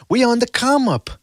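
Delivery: high-pitched pleading imitation; hammed-up mimicry of a hustling dad; mimicking a father-trainer. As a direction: plead the case in a put-on high voice. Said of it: "when he goes".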